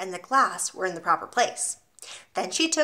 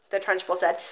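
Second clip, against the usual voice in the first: usual speaking voice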